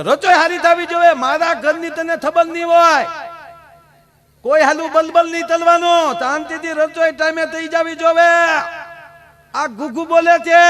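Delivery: loud, high, bossy husband laying down the law; mock-stern groom shouting house rules; stern scolding shout. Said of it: "મેં એક જ વાર ડારો દીધો".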